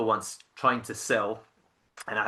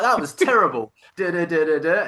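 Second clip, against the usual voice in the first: high, loud mock verdict running into gabble; wife going nuts; 6 semitones higher, 7 dB louder than usual. Wife judging husband's sales call, going nuts. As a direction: imitate a wife's tirade trailing into duh-duh-duh. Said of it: "just going nuts at me".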